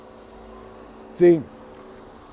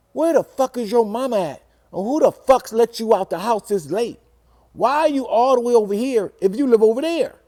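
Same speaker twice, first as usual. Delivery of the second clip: higher-pitched, heated scolding; scolding mom; 6 semitones higher than usual. Retold or imitated. Mom scolding the boy for being out so late, heated. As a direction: chew him out like an angry mom.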